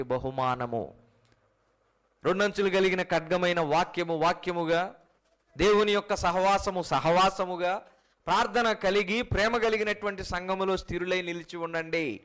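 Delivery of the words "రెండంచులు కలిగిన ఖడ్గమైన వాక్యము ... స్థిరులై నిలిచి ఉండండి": louder, raised preaching push; rally the church to stand firm; fervent exhortation. Acted. Preacher urging his congregation, loud fervent exhortation.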